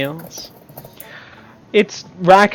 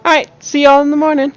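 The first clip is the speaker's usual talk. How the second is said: barmaid voice; 8 semitones higher, 4 dB louder, faster than usual. Acted. Barmaid farewell, fast.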